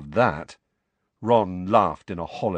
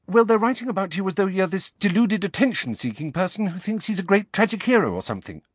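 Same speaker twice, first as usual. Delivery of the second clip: put-on much higher, rushed girl's voice; very fast; 13 semitones higher, 4 dB louder, faster than usual. A narrator's reading. Hermione tells Harry very fast, to spare him. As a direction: get it out quickly. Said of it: "very fast".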